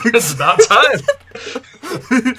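can barely get it out for laughing. Real, not acted. Laughing at queen joke.

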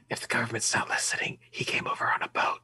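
whispered confidential aside; secretive whisper. Pretend. Grandson hides grandpa's story from government, secretive whisper. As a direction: whisper it as if officials might overhear.

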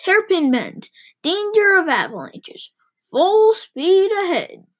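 high-pitched announcements, drawn out at the end; gleeful calling out. A narrator's reading. Driver calling out hazards as he speeds, gleeful. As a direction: build to a long, high final cry.

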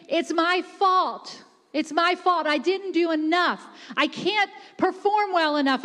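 insistent preacher, piling up the phrases; building impassioned preaching. Real, not acted. Preacher to congregation about toiling for God, building fervently. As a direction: build steadily to a high, driving climax.

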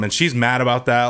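ordinary talk, nothing marked

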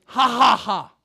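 loud acted ha-ha-ha; mock triumphant laughter. God laughing at the enemy, loud acted laugh. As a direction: laugh loudly in the enemy's face. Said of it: "is up there and like".

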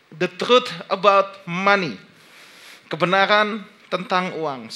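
normal talking voice

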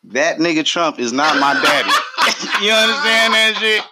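high and laughing; giggly stoned talk; stoner. Real, not acted. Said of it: "I'm drunk".

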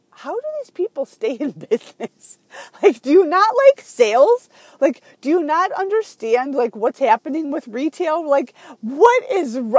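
loud, high, incredulous rant; half-laughing at how bad the store is; exasperated disbelief.

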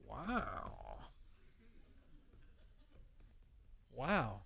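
hushed, low, drawn-out wow; stunned, hurt wow. Retold or imitated. Wife after being yelled at by husband, hushed disbelief. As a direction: say it very softly, taken aback. Wife's stunned reply to the yell.

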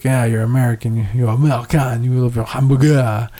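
mock a haughty French person in a bad French accent; horrible French accent. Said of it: "Apologies for my horrible French accent".

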